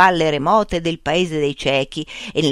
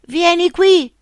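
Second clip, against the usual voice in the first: high, louder beckoning voice; third blind man; 13 semitones higher, 5 dB louder, slower than usual. A narrator's reading. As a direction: call him over in a high strange voice. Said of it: "disse il terzo cieco". (terzo cieco, voce alta e insistente)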